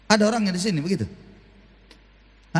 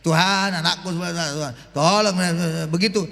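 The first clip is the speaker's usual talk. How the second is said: hasty prayer; 7 semitones lower than usual. Shown as a demonstration. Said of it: "panjang kali doanya".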